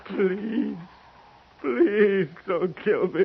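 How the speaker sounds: loud, slow, sarcastic begging; taunting Quince with a fake plea for mercy; mock pleading. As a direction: beg like it's a joke. Patchen fakes begging, mocking Quince.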